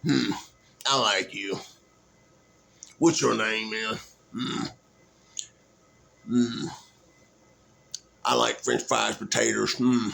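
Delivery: low, grunting Sling Blade voice; Sling Blade impression. Vlogger retelling the movie, low Sling Blade grunting. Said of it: "But he was like".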